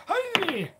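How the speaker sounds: high, loud cheery greeting; bright calling out. Acted. Player calling out a loud cheery hello to the mysterious voice. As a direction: call out a bright hi.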